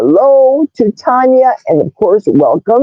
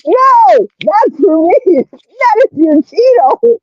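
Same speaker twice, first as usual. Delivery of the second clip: playful protest; 10 semitones higher than usual. Real, not acted. Reacting to cake comment.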